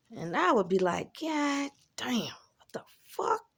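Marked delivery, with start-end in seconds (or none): 1.2-3.4 breathy whispered disbelief, slow; exasperated whisper. Retold or imitated. Telling about guys bitching, whispered exasperation. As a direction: hiss it out in disgusted disbelief. Exasperated reaction voiced in story.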